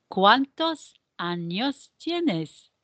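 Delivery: "¿cuántos años tienes?" slowly, each word set apart; slow choral model. Shown as a demonstration. Teacher leads the question all together, slow and measured. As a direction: lead a class through it word by word. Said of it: "all together".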